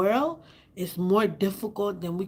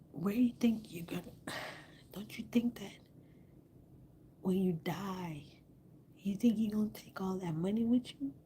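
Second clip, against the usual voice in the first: whispering; 9 dB quieter than usual. Real, not acted. Hushed taunt at rich people.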